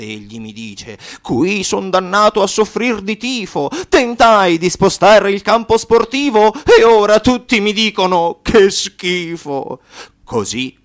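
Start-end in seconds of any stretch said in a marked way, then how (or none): 1.2-8.4 dannato che si lamenta. Acted. Lamento del dannato di Mafiopoli. 8.4-9.8 schifato, strascicato. Acted. Citazione dentro la battuta.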